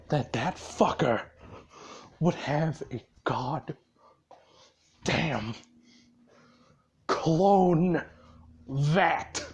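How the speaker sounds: low, hushed, word-by-word menace; acted controlled fury. Boss ordering Pox to find the clone vat, acted controlled fury. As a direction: spell it out with a pause between words.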